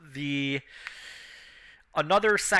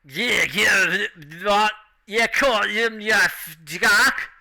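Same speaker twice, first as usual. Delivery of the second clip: loud, slurred orc holler; drunk orc; 6 semitones higher, 6 dB louder, slower than usual. Acted. Drunk orc bellowing at the table, loud and slurred.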